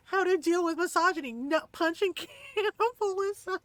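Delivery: high-pitched, cracking up on the punchline; retold punchline dissolving into laughter; laughing quote. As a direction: quote it through helpless giggles. Quotes video title while cracking up.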